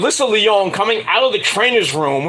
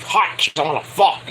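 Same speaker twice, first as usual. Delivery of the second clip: ordinary talk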